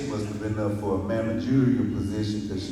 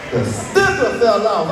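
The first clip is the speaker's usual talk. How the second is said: slow, higher and louder, milking the moment; dramatic reveal; 8 semitones higher, 5 dB louder than usual. Retold or imitated. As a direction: land the reveal slowly and big. Dramatic reveal of falling sticker.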